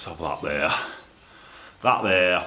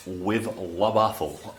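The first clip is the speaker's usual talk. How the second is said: lightly put-on French, slow La Baffle; mock French; 3 dB quieter, much slower than usual. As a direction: say La Baffle like a fancy French product, slowly.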